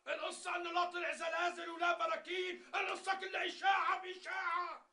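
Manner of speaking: very high, loud yell over commotion; high-pitched shouted revelation; shouting the news. Acted. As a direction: announce it at the top of the voice.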